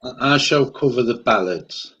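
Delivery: ordinary talk, nothing marked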